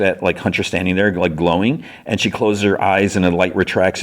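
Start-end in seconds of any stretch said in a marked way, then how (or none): none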